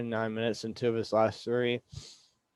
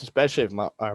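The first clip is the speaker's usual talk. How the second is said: ordinary delivery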